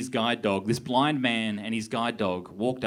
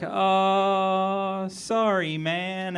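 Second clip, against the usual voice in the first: pitched up, nervous little sorry; awkward flustered apology; 8 semitones higher, much slower than usual. Retold or imitated. Comic telling himself to kid outside shop, nervous higher apology. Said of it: "was just like".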